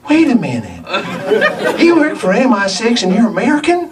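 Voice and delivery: imitated incredulous Southern question; mimicked sudden realization. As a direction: stop short, then ask in high-pitched disbelief. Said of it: "she stopped herself and said".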